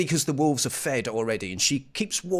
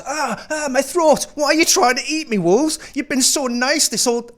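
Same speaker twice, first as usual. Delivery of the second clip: mock panic; 10 semitones higher, 6 dB louder than usual. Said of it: "she goes".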